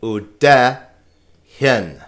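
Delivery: "Ude hin" with "de" hit loud; stress on second syllable. Shown as a demonstration. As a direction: say u-DE, pause, then "hin". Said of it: "because the accent is here".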